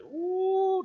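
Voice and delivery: high imitated ooh of the woman; imitated whoop. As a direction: mimic her sudden outburst. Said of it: "die begon zo". (nagedane hoge uitroep)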